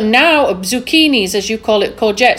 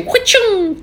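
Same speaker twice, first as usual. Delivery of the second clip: whip crack; 10 semitones higher than usual. Retold or imitated. Whip crack sound effect.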